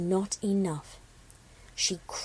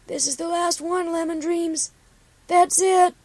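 high call ending in a loud shout; 8 semitones higher, 4 dB louder than usual. A narrator's reading. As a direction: call it down the hill from far off. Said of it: "Copper called down".

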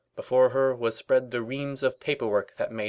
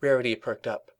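ordinary delivery

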